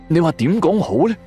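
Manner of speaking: usual speaking voice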